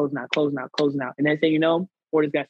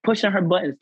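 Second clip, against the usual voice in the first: ordinary talk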